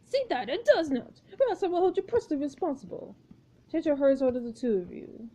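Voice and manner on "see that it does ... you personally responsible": lightly voiced imperious director; a touch of director's exasperation; scolding film director. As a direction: tell off an assistant, stiffly formal. Marcus scolds his assistant.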